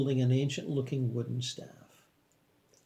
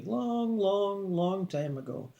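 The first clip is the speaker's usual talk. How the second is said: high, drawn-out, majestic words; slow and majestic; 6 semitones higher, much slower than usual. A narrator's reading. Old man recalling an ancient shared life, slow and majestic. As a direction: stretch each word out slowly and grandly. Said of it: "explaining slowly and majestically".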